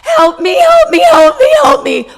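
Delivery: high, loud half-laughing cries of help me; mock desperate yelling, rising to a squeal; laughing as she replays yelling help me over and over; retold yell for help. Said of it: "I yelled".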